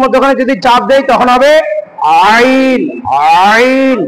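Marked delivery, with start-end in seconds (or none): 2.3-4.0 আইন with the throat squeezed in the middle; throat-pressed ayn. Shown as a demonstration. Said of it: "কণ্ঠনালীর মধ্যখানে চাপ দিয়ে".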